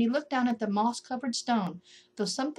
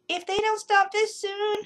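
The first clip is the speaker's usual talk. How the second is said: high, sleepy complaining voice; a cross little voice under the ground; grumpy voice from below; 12 semitones higher, 4 dB louder than usual. A narrator's reading. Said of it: "a voice said".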